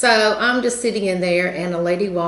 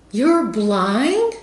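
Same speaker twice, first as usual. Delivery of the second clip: high, louder imitating the lady's surprise; surprised question; 5 semitones higher, 4 dB louder than usual. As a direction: imitate her amazement, pitched up. Retold lady hears she's blind.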